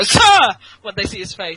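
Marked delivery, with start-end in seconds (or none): 0.0-0.4 much higher, louder surprised sir; startled correction. Retold or imitated. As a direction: jolt up as you realise. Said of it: "they go".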